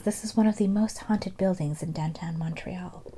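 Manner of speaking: hushed, eerie whisper; dramatic whisper. Acted. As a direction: whisper the spooky claim. Dramatic haunted claim.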